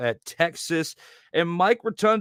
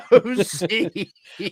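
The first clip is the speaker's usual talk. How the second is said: laughing while saying it; 4 semitones higher, 4 dB louder, much slower than usual. Real, not acted. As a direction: giggle through it. Laughing repeat.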